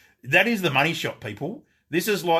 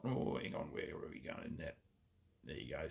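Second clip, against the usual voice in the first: muttered to himself, much softer; whispered aside; 9 semitones lower, 18 dB quieter than usual. Real, not acted. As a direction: whisper it under your breath while fiddling. Muttering aside while adjusting optic.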